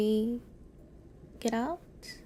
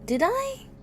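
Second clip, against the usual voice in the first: surprised squeak; 14 semitones higher, 5 dB louder than usual. Real, not acted.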